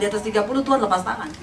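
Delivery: fast, laughing through the punchline. Real, not acted. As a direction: rush the joke out with a laugh. Punchline about God letting go. (tertawa sambil cepat)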